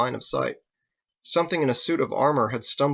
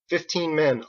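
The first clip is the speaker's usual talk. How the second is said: plain reading voice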